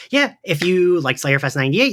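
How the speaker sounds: ordinary delivery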